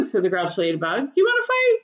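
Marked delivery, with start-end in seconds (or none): 1.2-1.8 high, pushy taunting challenge; challenging. A narrator's reading. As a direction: taunt them into a fight. Grouchy ladybug challenges.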